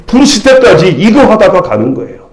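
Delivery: normal talking voice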